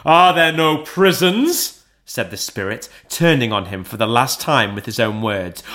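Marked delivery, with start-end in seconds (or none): 0.1-1.8 high, loud, slow mocking question; bitter retort. A narrator's reading. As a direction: throw his own words back at him. Spirit throws Scrooge's words back.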